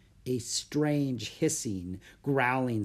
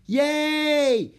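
high, loud delighted "Yay!"; girl cheering from a tree, high and loud; yelled cheer; 14 semitones higher, 8 dB louder than usual. Acted. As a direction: cheer from up above. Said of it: "Annie yelled from overhead".

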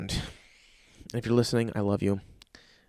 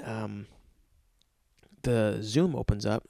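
ordinary delivery

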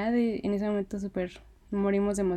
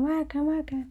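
playful high-pitched pretend shout; imitated war cry; 7 semitones higher than usual. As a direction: imitate a fan calling out, pitched way up. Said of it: "empezó ella a gritarles así como".

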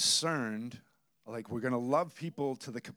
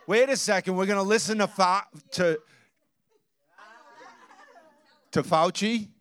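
louder, higher, incredulous protest; indignant outburst; 5 semitones higher, 8 dB louder than usual. Retold or imitated. Pastor retelling his outburst at the cashier, mock indignant. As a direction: let the outrage spill out, hammed-up. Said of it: "And I'm like".

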